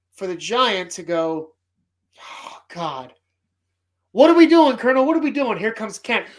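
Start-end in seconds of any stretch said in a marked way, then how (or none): 2.2-5.6 loud, high panic at the colonel; hammed-up frantic officer; what are we doing, yelled in alarm; panicked general. Panicked general in movie scene.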